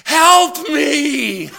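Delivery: high, loud plea with a laugh in it; desperate cry for help. Retold or imitated. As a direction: shout it out like you're stuck in traffic and at your wits' end. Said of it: "and it comes out".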